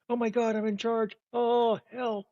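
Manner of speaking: high, laughing alarm; panicking at being put in charge; flustered panic. Real, not acted. Suddenly left in charge.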